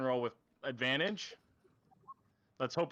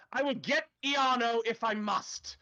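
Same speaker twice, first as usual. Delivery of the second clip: higher, slow and deliberate official's voice; nervous official's threat; 8 semitones higher, 4 dB louder, slower than usual. Acted. Official at knifepoint offers to fetch Iarno, higher and slow. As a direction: draw out the threat carefully. Repeated in plain voice at 158.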